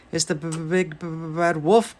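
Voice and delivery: stuttering, teeth-chattering pig; scared pig stutter; stammering fright. Acted. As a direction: stammer it out in terror. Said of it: "Boris chattered".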